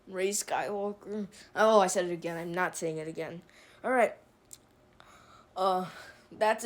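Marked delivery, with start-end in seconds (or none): 0.1-1.3 "Rey Skywalker" said much softer, reluctant and grudging; disgusted mutter. Real, not acted. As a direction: force out "Rey Skywalker" as if it hurts. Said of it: "I hate those words".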